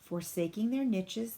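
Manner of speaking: ordinary delivery, nothing marked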